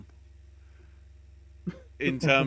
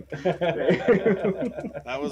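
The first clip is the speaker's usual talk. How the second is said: laughing; 4 semitones higher, 7 dB louder than usual. Real, not acted. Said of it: "I think that was a close second".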